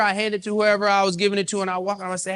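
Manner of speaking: usual speaking voice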